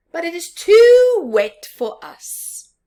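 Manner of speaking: high, loud whiny protest; complaining fox. Acted. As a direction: wail that it is too wet.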